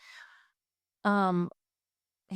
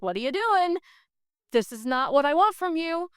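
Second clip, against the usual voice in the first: high-pitched, exasperated telling-off; incredulous, high retold reprimand; mock scolding; 9 semitones higher, 3 dB louder than usual. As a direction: play God scolding her, voice pitched up. God scolding her, acted out.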